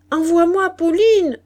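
high, loud call to summon a servant; shouted order. A narrator's reading. Daughter calls from bed for her maid, shouting. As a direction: call it out through the house. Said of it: "cria Moïna".

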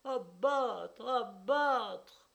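high, loud desperate shout; frantic cry. Acted. Wife crying out over her swallowed husband, frantic loud shout. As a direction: scream it like a woman possessed. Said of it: "Helena ne trouvait qu'un seul cri".

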